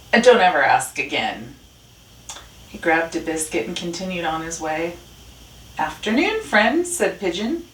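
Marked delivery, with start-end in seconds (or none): 0.1-1.6 low, loud grumpy Rat; grumpy rebuff. A narrator's reading. Rat snubs a neighbor's greeting, low and grumpy. 5.8-6.9 high, lilting pigeon greeting; friendly pigeon. A narrator's reading. Pigeon greets Rat as a friend, high and friendly.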